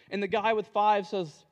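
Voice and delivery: normal talking voice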